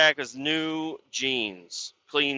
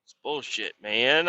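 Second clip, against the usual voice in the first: usual speaking voice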